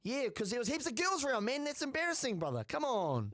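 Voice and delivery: high-pitched, incredulous protest; exasperated self-defence. Real, not acted. Embarrassed man justifies hiding condoms to his mate, exasperated. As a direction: plead your case, voice pitched right up.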